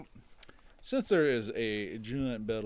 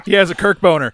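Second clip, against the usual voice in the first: loud, high, helpless with laughter; laughing hard; 9 semitones higher, 15 dB louder, faster than usual. Real, not acted. Player teasing a friend at the table, laughing loudly. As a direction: shout it through real laughter. Joking amid uproarious laughter.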